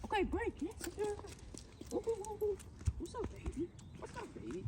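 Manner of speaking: very high, squeaky hype-up voice; excited dog talk. Real, not acted. Trainer hyping up her dog after a rep, squeaky high excited voice. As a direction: get him excited with a high pet voice.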